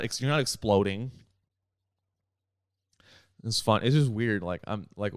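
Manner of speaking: usual speaking voice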